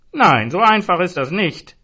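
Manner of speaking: high, loud insisting; insistent. A narrator's reading. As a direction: press the point hard and loud. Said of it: "bohrte Zoran".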